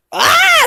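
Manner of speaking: short high-pitched yelp; mock scream. Parent searching book with child, high playful scream. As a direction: cry out in playful alarm.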